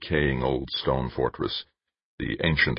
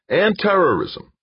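"terrorism" much higher and louder, hammered; emphatic agreement; 5 dB louder than usual. A narrator's reading. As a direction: seize on "terrorism" and punch it. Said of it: "Kinski agreed".

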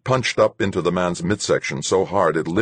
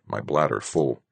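usual speaking voice

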